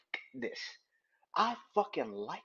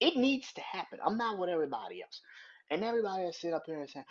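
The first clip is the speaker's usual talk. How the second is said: ordinary talk, nothing marked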